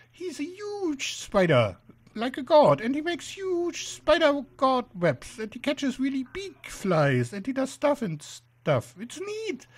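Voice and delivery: slow, high, awed spider; high-pitched spider preacher. Acted. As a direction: marvel slowly in a high spider voice. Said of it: "shrill, high-pitched voice sort of spoils the effect".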